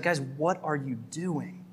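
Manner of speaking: soft, slow, gentle question; calm parental plea. Retold or imitated. Paul at the table with the Galatians, softly asking. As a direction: ask like a relaxed parent at the table. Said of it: "this posture of almost like a parent sitting at a table".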